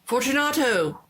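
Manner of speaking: calling aloud. A narrator's reading. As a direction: call into the dark niche. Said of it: "I called aloud".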